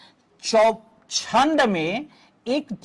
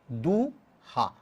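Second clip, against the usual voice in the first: "दूहा" very slow, long du, pause, softer low ha; syllable by syllable; 8 semitones lower, 8 dB quieter, much slower than usual. Shown as a demonstration. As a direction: break "दूहा" into two drawn-out syllables. Teacher splits source word into syllables. ("दूहा" को दू... हा तोड़कर, धीरे)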